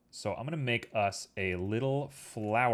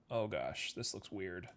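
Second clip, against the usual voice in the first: softer muttered aside under his breath; muttered to himself; 4 semitones lower, 11 dB quieter than usual. Real, not acted. Streamer mutters to himself at a glitching model, softer. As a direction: drop to a soft mutter as the model goes wrong.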